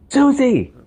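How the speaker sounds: put-on mother shouting at her toddler near the coffee table, loud alarmed yell; yelling the child's name. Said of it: "She yells its name as loud as it can".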